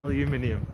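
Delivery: "Aluminium" as al-yoo-MIN-ee-um; British pronunciation. Shown as a demonstration. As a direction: give "Aluminium" all five syllables, British style. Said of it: "it's not aluminum".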